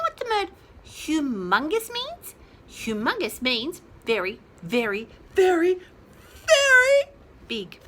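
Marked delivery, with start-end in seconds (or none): 6.5-7.0 very pitched up high and loud; emphatic very. Shown as a demonstration. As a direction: hit the last very hard and high. Stretching very for emphasis.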